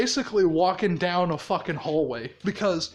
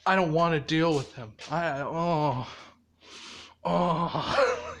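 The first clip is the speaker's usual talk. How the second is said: low groaning reluctance; exasperated; 4 semitones lower, 3 dB quieter than usual. Retold or imitated. Izuku not wanting to deal with him, groaning and weary. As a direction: groan it out, sick of dealing with this. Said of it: "he's just like".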